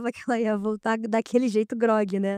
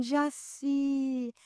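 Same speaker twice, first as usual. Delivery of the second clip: softer, strange voice calling a name; otherworldly voice; 7 dB quieter than usual. Retold or imitated. Mother in a trance calling her daughter, softer strange voice. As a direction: say the name as if someone else speaks through her. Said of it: "falou assim".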